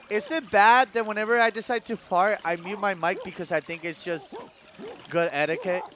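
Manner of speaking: ordinary delivery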